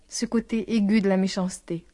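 usual speaking voice

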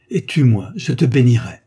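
supplication passionnée. Acted. Elle le supplie de frapper.

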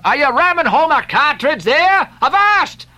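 high, scolding shouted rebuke. A narrator's reading. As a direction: bark at him to stop at once. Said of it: "cried Stubb".

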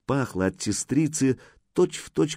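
ordinary delivery

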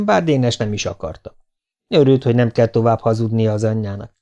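usual speaking voice

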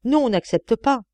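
understated cry of protest; urgent cry. A narrator's reading. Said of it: "J'avais envie de lui crier".

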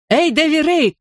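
high-pitched hail to a friend; calling out. A narrator's reading. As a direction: get a friend's attention from afar. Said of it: "крикнул я".

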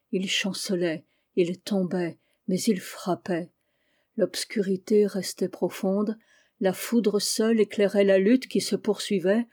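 plain reading voice

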